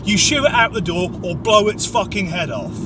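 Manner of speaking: usual speaking voice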